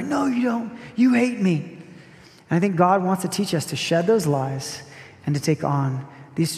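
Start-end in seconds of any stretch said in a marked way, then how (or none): 0.0-1.8 put-on childish protest. Us answering God like the daughter.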